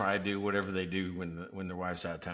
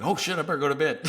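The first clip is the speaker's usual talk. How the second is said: startled realization; 5 semitones higher, 4 dB louder, much faster than usual. Retold or imitated. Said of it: "I'm like".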